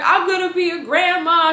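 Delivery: high-pitched retold tearful outburst; mock ugly cry. Mom to pregnant daughter, mock ugly cry. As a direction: imitate mom's weepy news, pitched well up. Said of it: "She's doing the ugly cry".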